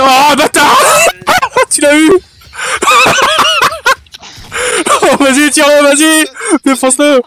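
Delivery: loud, high excited yelling; hyped shouting. Real, not acted. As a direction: cheer your teammate on loudly. Hype after big kill.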